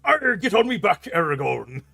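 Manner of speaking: high, loud hammed-up pirate dragon; sailor-accented pirate voice. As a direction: growl it out like a cartoon pirate. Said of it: "They just all sound like pirates".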